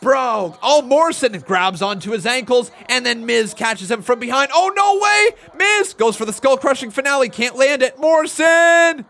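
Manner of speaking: loud, high commentator calling the count; excited play-by-play. Acted. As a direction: call the action like a wrestling announcer. Calls the match like an announcer.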